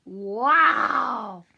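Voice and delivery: loud amazed exclamation; wow. Acted. Storyteller amazed at the children's gold, loud wow. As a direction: marvel at the gold.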